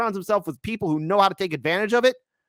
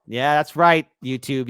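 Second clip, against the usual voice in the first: ordinary talk, nothing marked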